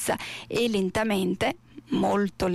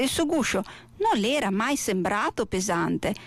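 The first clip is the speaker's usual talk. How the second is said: ordinary delivery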